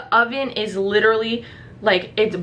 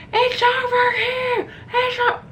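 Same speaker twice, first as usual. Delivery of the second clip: very high, drawn-out mock holler; calling out; 13 semitones higher than usual. Waving fire trucks down to the complex, high mock calling. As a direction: flag them down in a squealed call.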